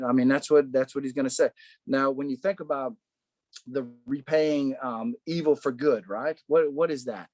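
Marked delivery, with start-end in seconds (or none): none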